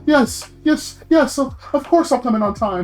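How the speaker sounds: mock the obedient servant for Master Kyle; mock subservient. Mocking what the manager wanted to hear, hammed-up groveling. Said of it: "wanted to shook me up and be like".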